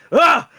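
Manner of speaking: high, loud cry of effort; sudden acted yelp of frustration; frustrated stumbling yell. Fighter stumbles after missing.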